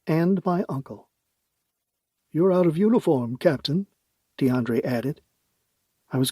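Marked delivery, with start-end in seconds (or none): 2.3-3.9 higher, amused official; teasing president. Acted. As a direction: needle her playfully. DeAndre teases.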